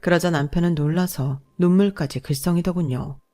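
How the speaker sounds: ordinary delivery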